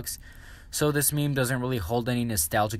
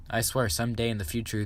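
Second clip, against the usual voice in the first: ordinary talk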